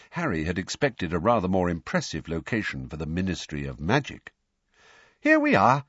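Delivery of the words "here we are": high, loud, cheery announcement; bright. A narrator's reading. Mr Weasley arriving at the phone box, bright. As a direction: sing out brightly, well up in pitch. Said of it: "said Mister Weasley brightly".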